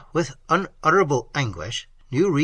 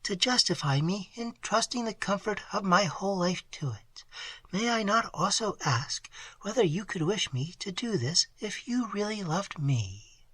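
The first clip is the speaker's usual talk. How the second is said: put-on higher, hushed, almost whispered young woman; Emily's voice; 6 semitones higher, 7 dB quieter than usual. A narrator's reading. A young woman answering her lover in a hush. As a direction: breathe her doubts softly. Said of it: "said Emily".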